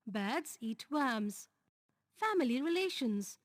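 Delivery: normal talking voice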